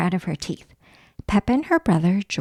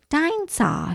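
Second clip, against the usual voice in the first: mild growl; 5 semitones higher, slower than usual. Acted. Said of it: "he growled".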